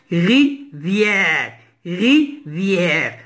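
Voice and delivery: "Rivière" in two separate syllables, ri, vière; split into syllables. Shown as a demonstration. Teacher cutting the word up for learners, split into syllables. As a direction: break "rivière" after ri, each part held. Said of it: "Deux syllabes".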